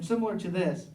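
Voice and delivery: usual speaking voice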